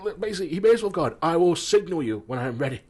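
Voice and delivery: fast, high, loud mock pomp; mock pompous chairman. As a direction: ham up a self-important chairman. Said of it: "he was like".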